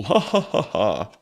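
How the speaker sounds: slow evil cackle; evil villain laugh. Acted. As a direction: laugh slowly like a tyrant. Villain laughs after boast.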